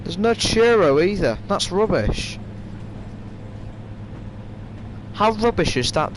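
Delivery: high, indignant complaint; it's rubbish and you mean it; outraged disbelief. Real, not acted. Indignant at missing server.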